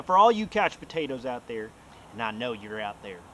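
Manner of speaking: usual speaking voice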